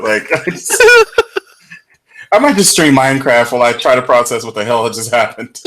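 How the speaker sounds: usual speaking voice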